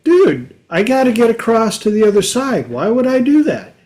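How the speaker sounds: high, fast indignant dude; squeaky put-upon scorpion voice; scorpion protesting. Retold or imitated. Scorpion protests frog's distrust.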